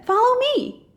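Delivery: high, breezy fish voice; chirpy Dory. A narrator's reading. Dory leading Marlin off, high and chirpy. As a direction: sing it out brightly. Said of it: "she said".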